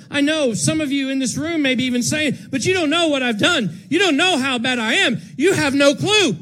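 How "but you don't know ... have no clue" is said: voiced objection.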